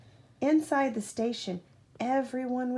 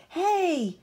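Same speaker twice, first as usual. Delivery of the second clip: high, louder call of hey; yelled hey; 8 semitones higher, 5 dB louder than usual. A narrator's reading. Man below yells up at George, loud call. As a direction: shout up to the monkey on the sign. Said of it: "yelled a man from below".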